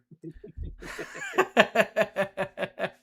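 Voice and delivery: long, high burst of laughter; real, drawn-out amused laugh; hearty laugh. Real, not acted. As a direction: laugh out loud at length. Amused by new guest's accent.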